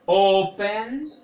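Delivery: "open" drawn out with the first syllable stressed; demonstrative reading. Shown as a demonstration. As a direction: stretch the O of "open" for the class. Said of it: "na minha leitura".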